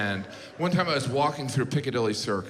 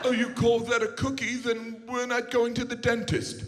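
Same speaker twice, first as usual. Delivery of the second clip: lightly put-on posh British voice, a bit higher; mock British accent; 7 semitones higher than usual. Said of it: "They're like".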